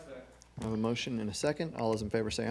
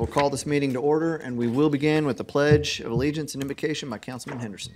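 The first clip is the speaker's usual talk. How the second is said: measured weighty baritone; 3 semitones higher, 8 dB louder than usual. Acted. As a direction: call the meeting to order with weight. Opens council meeting formally.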